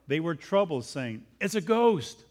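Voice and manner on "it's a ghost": startled disciples cry out, a touch raised; a sudden scared shout of ghost; frightened cry. Acted. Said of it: "they cried out for fear".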